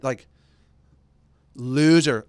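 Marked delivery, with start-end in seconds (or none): 1.5-2.3 loud mock jeer; taunting shout. Host dictating Trump's taunting tweet, loud jeer. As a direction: throw the insult out hard and loud.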